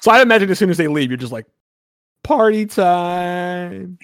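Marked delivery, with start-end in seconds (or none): 2.2-4.0 long drawn-out, higher-pitched party call; gleeful sing-out, held long; party cheer. Acted. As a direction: stretch each word out in celebration. Kids cheer as parents leave.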